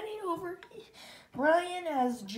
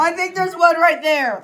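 laughing; 3 semitones higher, 11 dB louder than usual. Real, not acted. Laughs while pointing.

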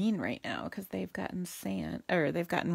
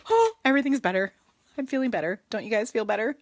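excited; 4 semitones higher, 6 dB louder, faster than usual. Real, not acted. Cheerful relief after stamping.

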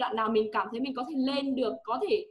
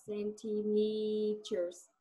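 very slow model word; 2 semitones lower, 4 dB quieter, much slower than usual. Shown as a demonstration. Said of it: "Chưa, centimeters".

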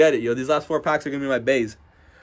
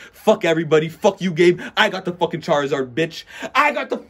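louder yelling, the last words jumping much higher; real gloating shout over the pull; triumphant scream; 4 semitones higher, 5 dB louder than usual. Real, not acted. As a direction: scream it in victory at your friends. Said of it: "I'm not gonna scream like that".

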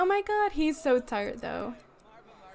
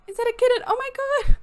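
much higher and louder, squealing delight; excited squeal; 10 semitones higher, 8 dB louder, faster than usual. Real, not acted. Spotting a kitten at the pet festival, squealing. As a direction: squeal like you just saw a kitten.

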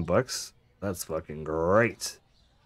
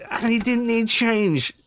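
drawn-out, much higher cry of amazement; amazed; 13 semitones higher, 3 dB louder, slower than usual. Real, not acted. Cashier marvelling at a sixty-one buck shop, slow high amazement. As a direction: stretch it out in disbelief at the total. Amazed customer paid exact.

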